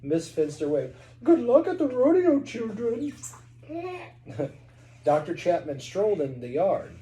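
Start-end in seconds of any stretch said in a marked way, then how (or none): 1.2-3.1 put-on sweet old lady voice. Mrs Finster waves goodbye. 3.7-4.5 high lady giggle. Acted. Mrs Finster laughs.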